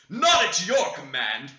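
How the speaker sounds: slow, loud refusal; angry retort. A narrator's reading. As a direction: throw it back word by word. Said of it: "retorted Hareton".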